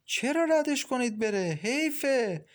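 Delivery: high-pitched leering protest; leering villain. A narrator's reading. Mashallah objecting to sending the girl away, leering. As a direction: object with oily interest, voice pitched well up.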